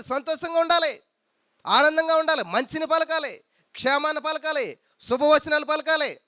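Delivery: high, ringing preaching voice; joyful exhortation. Acted. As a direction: urge them upward with each line. Exhortation at raised pitch.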